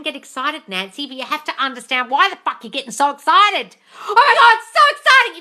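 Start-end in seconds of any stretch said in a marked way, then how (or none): 4.1-5.4 high, loud, hammed-up squeal of delight; mock gushing excitement. Mocking a fan who gets a text, high gushing squeal. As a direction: gush like a smitten fan.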